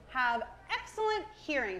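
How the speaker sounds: normal talking voice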